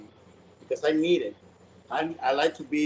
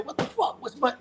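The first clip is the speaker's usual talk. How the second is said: sharp, high retold curse; angry outburst; 9 semitones higher, 3 dB quieter than usual. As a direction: snap it out like an angry man who lost his things. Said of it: "Carlito got pissed off".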